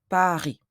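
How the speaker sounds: "Paris" as pa-REE, with no S; French silent S. Shown as a demonstration. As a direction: say "Paris" the French way, ending on a long EE. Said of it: "we don't pronounce the final S".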